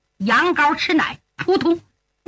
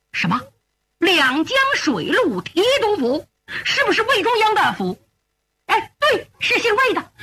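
alarmed exchange; 7 semitones higher than usual. Acted. Alarming news about the father.

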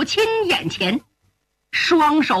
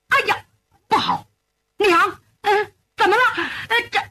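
alarmed, halting; 8 semitones higher, faster than usual. Acted. Son realises his father's danger.

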